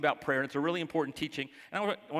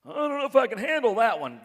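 higher, louder mock panic; mock overwhelmed God; 9 semitones higher, 8 dB louder than usual. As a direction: ham up a flustered God.

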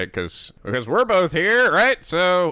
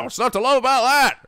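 normal talking voice

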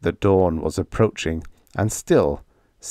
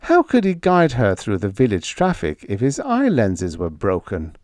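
usual speaking voice

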